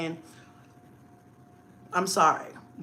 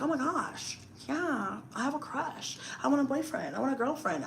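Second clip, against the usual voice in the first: put-on high, soft teen swooning; boy-crazy teenager; 6 semitones higher, 10 dB quieter than usual. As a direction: lightly mimic a teen with a crush. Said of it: "you're a teenager and you just decide".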